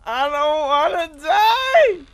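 slow, high, crying wail of panic; drawn-out wail. Real, not acted. Player begging to live in a horror game, long wail. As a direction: wail it out, rising high and loud at the end.

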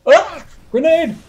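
high, loud yelp of alarm; startled alarm. Real, not acted. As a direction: shout a sudden warning mid-game. Startled by a grenade in game.

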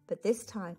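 ordinary delivery, nothing marked

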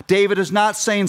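ordinary talk, nothing marked

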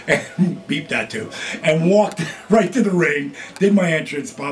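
ordinary talk

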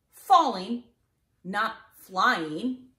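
plain reading voice

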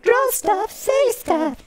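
very high, chirpy slogan; high sing-song. Acted.

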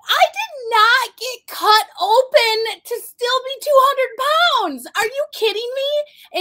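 high, loud and slow, incredulous to the doctor; shocked patient protesting, voice shooting up; outraged disbelief. Retold or imitated. Retelling her doctor's target weight, loud high outrage. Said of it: "And I was like".

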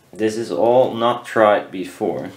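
normal talking voice

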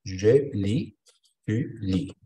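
"je lis, tu lis" with the final S left silent, "lis" ending on a bare "i"; "je lis" and "tu lis" ending cleanly on the vowel. Shown as a demonstration. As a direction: say "lis" as "li", no S at the end. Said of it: "on ne va prononcer pas la lettre S".

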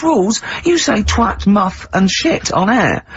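high-pitched incredulous retort; retold scornful comeback. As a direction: throw the objection back, pitched right up. Retold scornful comeback about rules.

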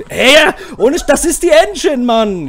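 full gamer rage outburst; real furious shout. Real, not acted. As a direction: scream the blame onto the engine. Raging at the engine.

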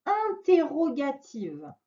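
"interrogative" stretched out slowly; teacher stretching "interrogative" so it sticks; slow, drawn-out term. Shown as a demonstration. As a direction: draw out "interrogative" for a child. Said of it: "On dit que c'est une phrase".

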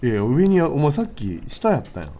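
usual speaking voice

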